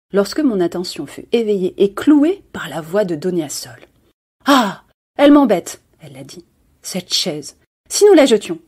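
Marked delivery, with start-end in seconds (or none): 4.5-8.6 a bored lady itching to toss the chair; irritated exclamation. Acted. Donia Sol wants to throw chair.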